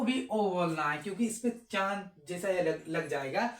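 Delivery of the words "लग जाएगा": usual speaking voice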